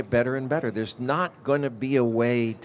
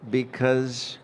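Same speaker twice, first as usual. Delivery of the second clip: ordinary talk, nothing marked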